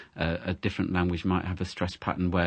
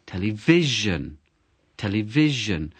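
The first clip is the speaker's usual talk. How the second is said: Television said slowly, first syllable hammered; exaggerated word stress. Shown as a demonstration. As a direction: overdo the stress on Television to remember it. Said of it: "So I really exaggerate it".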